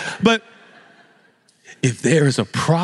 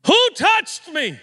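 slow, very high-pitched stern demand; stern Jesus; slower than usual. Acted. Jesus as we imagine him, calling out sternly. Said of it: "we hear him in his stern voice".

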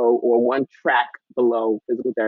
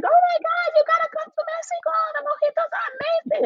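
hammed-up TV host voice, much higher, gushing and excited; Brooke Burke impression; 3 dB quieter than usual. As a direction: squeal it like a giddy travel-show host on a jet ski. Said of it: "and she's like".

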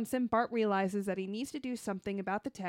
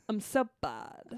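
put-on slow drawled Valley Girl line; ditzy Valley Girl, dragging it out; 4 dB quieter, much slower than usual. Shown as a demonstration. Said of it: "I'll do a taste of my Valley Girl".

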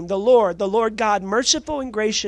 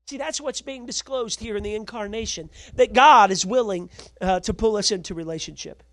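ordinary talk, nothing marked

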